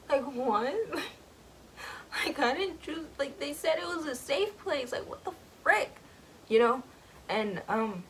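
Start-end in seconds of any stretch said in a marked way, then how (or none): 0.1-5.9 high, indignant squeaky questions; outraged disbelief. Retold or imitated. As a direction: cry out betrayed and baffled. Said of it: "And Gus is like".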